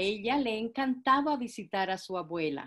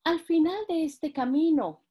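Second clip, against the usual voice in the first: put-on little girl voice; 7 semitones higher, 4 dB louder than usual. Caperucita answers the wolf.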